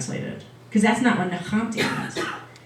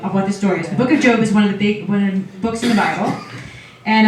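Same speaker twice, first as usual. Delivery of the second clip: normal talking voice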